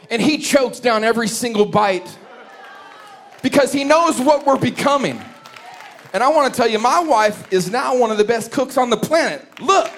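high, loud preacher build; preaching at full force. Acted. Preacher rising to the climax before the congregation, loud. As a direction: drive the point home to the church.

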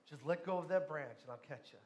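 soft, low heavenly voice; calm reassurance. Retold or imitated. God promises to catch the dangling man, calm and quiet. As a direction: repeat it quietly, dropping away at the end. God calmly repeats the instruction.

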